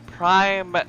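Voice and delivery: loud, drawn-out hype announcer; wired announcer voice. Shown as a demonstration. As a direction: pump it up like a primetime host. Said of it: "let me do my wired voice".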